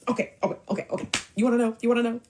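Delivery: high, rapid-fire repeats; agitated mock outburst; frantic giving in. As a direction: imitate him cracking under questioning. His retold cracking under questioning.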